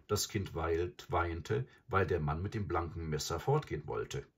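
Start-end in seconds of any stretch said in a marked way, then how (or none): none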